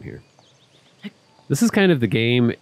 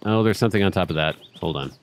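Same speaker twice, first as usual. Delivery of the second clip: ordinary talk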